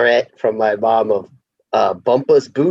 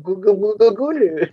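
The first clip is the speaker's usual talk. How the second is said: high baby-babble imitation; mock baby voice; 8 semitones higher than usual. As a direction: mimic a babbling baby teasingly. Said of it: "she's using her baby voice".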